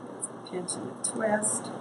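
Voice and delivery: soft whispered aside; whispering. Real, not acted. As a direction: whisper it to yourself. Repeats the crafting step phrase.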